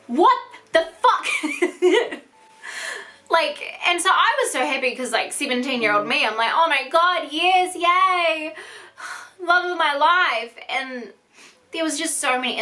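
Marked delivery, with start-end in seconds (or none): 0.1-1.3 loud, high disbelieving cry; a stunned teenager yelling at the news; shocked outburst. Retold or imitated. Her shocked reaction, retold. 1.3-2.2 high laugh. Real, not acted. Laugh after her shocked quote. 6.5-10.5 high, giddy squeal, then slow and dreamy; a teenager gushing that the relationship is back on; gushing teen. Retold or imitated. Seventeen-year-old self thrilled.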